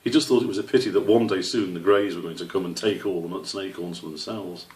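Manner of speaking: usual speaking voice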